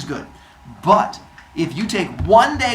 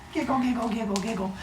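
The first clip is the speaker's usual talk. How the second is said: mock giggling; 6 semitones higher, 8 dB quieter, faster than usual. A narrator's reading. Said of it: "Hera runs away giggling".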